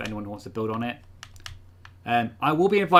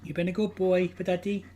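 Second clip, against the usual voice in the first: high cooing pet voice; doting dog talk; 7 semitones higher than usual. Real, not acted. Praising the dog.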